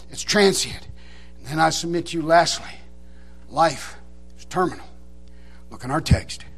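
low, soft, measured preaching; hushed, grave pulpit reading with long pauses; solemn sermon close. Real, not acted. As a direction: drop down quietly and let each point settle. Quiet sermon close and scripture reading.

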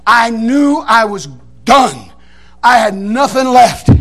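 very slow, weighted words; slow dramatic admission of defeat; spent and finished. Retold or imitated. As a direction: draw it out, pausing before the end. Worn out after the fight.

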